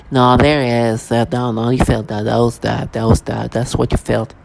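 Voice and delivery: low, offhand mimicry; nonchalant. Mimicking people who insist on what was felt, nonchalant. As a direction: drop the pitch and imitate their casual insistence. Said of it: "Using whatever nonchalant voice they can".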